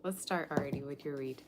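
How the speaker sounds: normal talking voice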